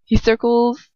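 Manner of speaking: normal talking voice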